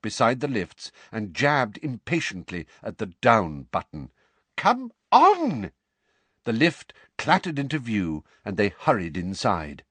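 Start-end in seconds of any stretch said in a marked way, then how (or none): 4.6-5.7 high, impatient snap. A narrator's reading. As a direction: urge the lift on. Jabbing the lift button.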